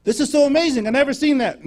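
high, loud imitation of an amazed pastor. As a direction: blurt it out in awestruck excitement. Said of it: "and he was amazed".

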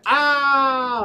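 high, loud "ow" yell; imitated scream of pain. Retelling a man's scream as his leg is squeezed, loud yelp. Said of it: "He screamed".

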